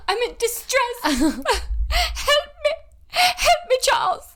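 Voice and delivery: very high, loud damsel in distress; glamorous old Hollywood star. Pretend. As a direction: plead like a swooning screen heroine. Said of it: "an old Hollywood actress".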